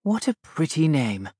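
put-on a kind princess stooping to a child; cartoon princess.

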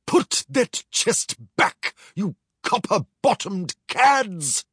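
a touch of pompous outrage; indignant commander. Acted. Commander protests to the pirates, mildly indignant. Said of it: "cried the ship's commander".

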